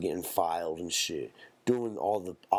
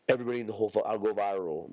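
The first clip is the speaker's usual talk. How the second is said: ordinary talk, nothing marked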